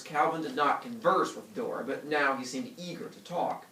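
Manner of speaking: ordinary talk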